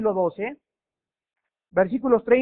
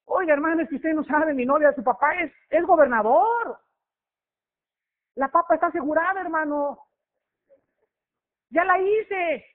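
put-on cocky boyfriend; 6 semitones higher than usual. Boastful young man voiced.

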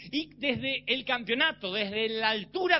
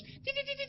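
"ti, ti" muy agudo y suave; sonido de láser imitado; 10 dB quieter than usual. Retold or imitated. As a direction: imita un juguete de luces. Imita lucecita de juguete. ("ti, ti, ti, ti" agudo y suave, como láser de juguete)

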